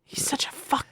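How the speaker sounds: hushed, breathy aside; whisper. Acted. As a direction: whisper it so he can't hear. Whispered aside about Dom.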